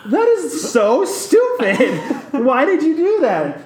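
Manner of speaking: high, loud incredulous laugh through the words; laughing disbelief. Retold or imitated. To friends, retelling scolding Hannah, laughing disbelief. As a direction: cackle at her silly choice. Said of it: "And I was like".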